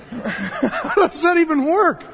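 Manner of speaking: laughing disbelief. Real, not acted. Laughing outrage at the committee.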